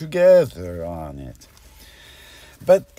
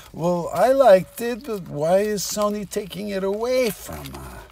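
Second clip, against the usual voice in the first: higher, lightly put-on character voice; lightly voiced protest, pitched up; Bela Lugosi; 7 semitones higher than usual. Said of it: "Said Bella Lugosi".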